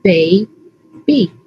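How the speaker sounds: put-on "Baby" as bay, pause, bee; chopped into syllables. Shown as a demonstration. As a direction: chop "Baby" into two separate syllables. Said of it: "chop the parts with me".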